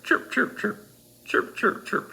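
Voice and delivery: high, unvoiced whistle-like chirps; imitated whistle chirps. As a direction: mimic a ref blowing short chirps through his whistle. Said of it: "and he's like".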